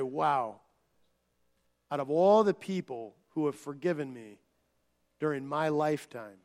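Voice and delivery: soft, low, sincere thanks; quiet heartfelt gratitude to his wife; tender to wife. Retold or imitated. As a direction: speak low and grateful to your wife. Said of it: "I'll say to my wife".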